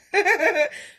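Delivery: loud, high burst of laughter. Real, not acted. Host telling of the weirdest thing, laughing out loud. Laughs at her own story.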